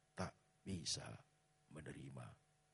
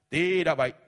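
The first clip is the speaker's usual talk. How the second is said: much higher, much louder shout; shouted warning; 12 semitones higher, 20 dB louder than usual. Real, not acted. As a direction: yell the warning out. Pastor warns congregation. (teriakan peringatan)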